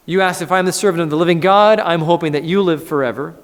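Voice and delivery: eager reply. Acted. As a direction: answer the worried king with eager joy. Voicing Daniel's reply.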